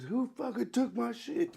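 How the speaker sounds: slow, high-pitched imitation of yelling; mock angry yell. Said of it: "he's yelling at the".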